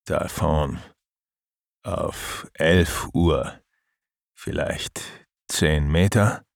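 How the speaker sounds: slow, steady voice over radio. Acted. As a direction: speak slowly through a crackly radio. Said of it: "die stabile und immer etwas zu langsame Stimme von Mike im Funk".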